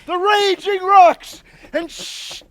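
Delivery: bellowing, slow Hercules rant; lofty ranting tyrant. Acted. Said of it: "This was lofty".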